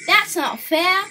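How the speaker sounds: put-on boy's loud, high complaint; crying out. A narrator's reading. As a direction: cry out that it's unfair. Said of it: "Hugh Thomas cried out".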